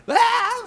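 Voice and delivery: high loud yell; drunken scream. Retold or imitated. Drunk friends in the studio screaming, high loud yell. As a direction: let out a wild drunken scream. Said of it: "like, screaming through the songs".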